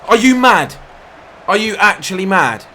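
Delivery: high, loud disbelieving outrage at the referee; outraged shout. Real, not acted. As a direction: yell at the ref, furious. Penalty not given, outrage.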